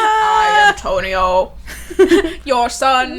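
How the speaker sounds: slow, loud, hammed-up proclamation; mock dramatic reveal. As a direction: draw out the big reveal, laughing.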